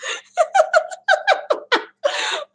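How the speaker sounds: high, squealing giggles; helpless laughing fit. Real, not acted. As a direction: crack up breathlessly. Cracks up at her attempts.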